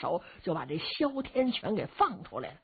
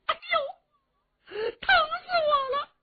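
very high, tearful shriek; hammed-up shrill cry of pain; yelling in pain; 3 dB louder, slower than usual. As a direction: howl as if in agony. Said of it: "他这嚷的疼死他了".

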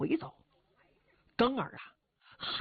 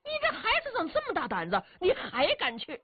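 shocked uncle; 11 semitones higher than usual. Acted.

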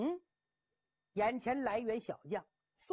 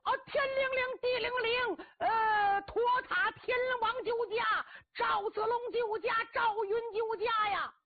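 higher, louder crying plea for rescue; frantic wailing cry; 11 semitones higher, 4 dB louder than usual. Acted. Captive braggart screams for heroes to rescue him, frantic wail. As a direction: howl for any god to save you. Cheng Yaojin wails for rescue again.

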